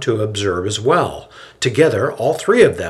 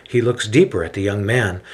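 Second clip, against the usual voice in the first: plain reading voice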